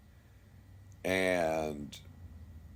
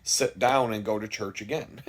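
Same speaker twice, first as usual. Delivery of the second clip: ordinary talk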